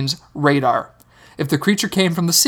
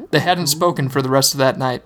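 ordinary delivery

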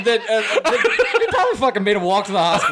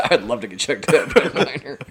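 laughing; 7 semitones lower than usual. Real, not acted. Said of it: "Don't isolate that clip".

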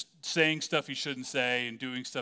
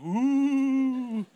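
high, loud ghostly ooh; playful ghost moan; spooky ooh; 10 semitones higher, 6 dB louder than usual. Pretend. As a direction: tease them with a spooky wail. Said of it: "I would have been like".

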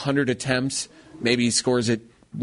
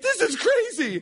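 very high, stunned broadcaster; amazed commentator. Retold or imitated. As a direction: squeak out the disbelief. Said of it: "He's like".

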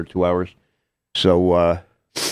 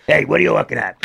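Seymour Lustig voice; 5 semitones higher than usual. Retold or imitated. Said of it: "I still love doing the voice".

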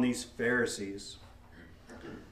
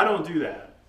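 usual speaking voice

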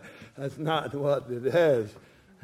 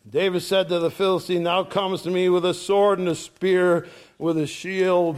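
raised, bold declaiming voice; preaching scripture; 4 semitones higher, 3 dB louder than usual. Acted. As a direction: proclaim it to the giant. David's proclamation read aloud.

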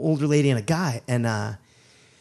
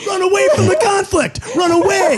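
very high, loud comic wail; high-pitched yelling through laughter; mock panicked shout; 6 dB louder than usual. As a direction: shriek a retreat in hammed-up panic. Mock panicked call to flee.

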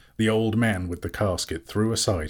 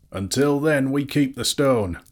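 raised, ringing declaration; called out; 7 semitones higher, 4 dB louder than usual. A narrator's reading. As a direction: call it out high and firm. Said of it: "cried Bard".

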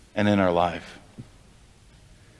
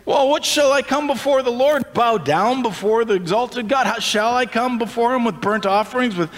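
Israelites asking; 9 semitones higher than usual. Acted. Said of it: "They say".

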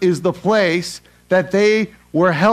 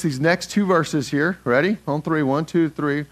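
usual speaking voice

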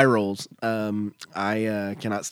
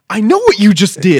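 louder accusing call-out; mock accusing call-out; 6 semitones higher, 10 dB louder than usual. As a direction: call her out loudly. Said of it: "And I'm like".

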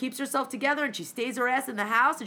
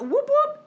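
much higher, louder whoop whoop like a police siren; siren imitation; 13 semitones higher, 5 dB louder than usual. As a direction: mimic a cop car siren.

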